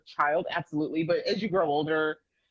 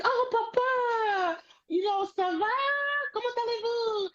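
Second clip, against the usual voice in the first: very high, slow, proud French greeting; eager high schooler. Retold or imitated. Teen greeting her father proudly in French, very high. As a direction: sing out the French sweetly. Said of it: "I was like".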